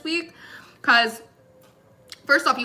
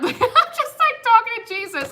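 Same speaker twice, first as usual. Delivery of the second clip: laughing; 12 semitones higher than usual. Real, not acted. Laughing at herself.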